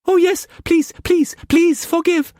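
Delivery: put-on very high, pleading girlish voice; pleading young woman. A narrator's reading. Mei Mei begging Struan not to whip hard, high frightened pleading. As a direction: beg him, small and frightened.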